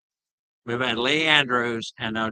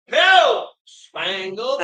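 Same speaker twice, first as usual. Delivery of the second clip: pitched way up, sing-song hello; high sing-song greeting; 8 semitones higher, 7 dB louder, much slower than usual. Real, not acted. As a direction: call out a bright, high hello. Greeting the clown Spangle.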